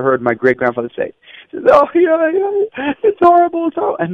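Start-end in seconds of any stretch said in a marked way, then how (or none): 1.7-4.0 high, wailing mock cry of it's horrible; crying lament. Great-grandfather mourning on the floor, high wailing cry. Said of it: "he was crying so much".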